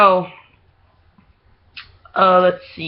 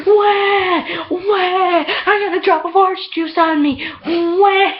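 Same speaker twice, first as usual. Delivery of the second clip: high-pitched, exaggerated crybaby wail; mock bawling; 12 semitones higher, slower than usual. Girl whining over spilled orange juice, mock bawling. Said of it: "she comes in bawling".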